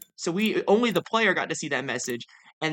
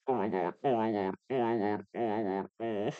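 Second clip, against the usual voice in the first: low, hushed, overwhelmed repetition; freaking out quietly; 8 semitones lower, 8 dB quieter than usual. Real, not acted. Player overwhelmed after screaming over Ava, stunned and hushed. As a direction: murmur it softly, stunned with joy. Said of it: "I'm freaking out".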